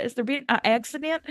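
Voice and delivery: lightly put-on Southern accent with a rising question; mock southern drawl. Podcaster repeats Maggie's line, light mock Southern accent. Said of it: "I was like".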